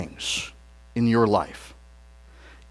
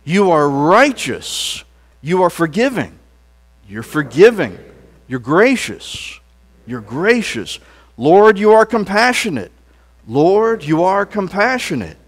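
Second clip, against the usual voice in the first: call and response praise. Shown as a demonstration. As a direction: proclaim each line for the congregation to repeat. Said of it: "Let's say that".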